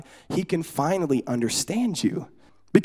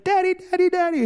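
much higher, babbling daddy over and over; baby voice; 12 semitones higher than usual. Retold or imitated. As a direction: repeat it like an eager toddler. Baby repeating its word.

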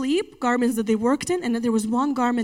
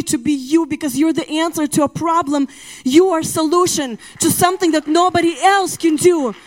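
louder, higher preaching that keeps building; full-force preaching; 5 semitones higher, 5 dB louder than usual. Acted. Preacher rousing the congregation against copying others, at full force. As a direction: drive it home like a pulpit climax.

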